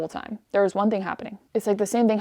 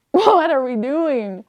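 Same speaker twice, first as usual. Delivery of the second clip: loud, high, stretched-out wail of annoyance; exasperated outburst; 5 semitones higher, 7 dB louder, slower than usual. Retold or imitated. Reader yelling at a pointless book, exasperated. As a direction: blow up, dragging out each word louder and higher. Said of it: "verbalizing every annoyance".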